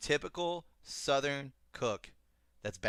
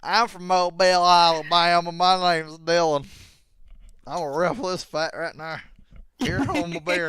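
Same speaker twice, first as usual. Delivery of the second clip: loud, high, drawn-out Southern drawl; hammed-up Alabama twang; mock Southern accent; 6 semitones higher, 11 dB louder than usual. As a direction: exaggerate a friend's Alabama accent. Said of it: "My accent is not that bad".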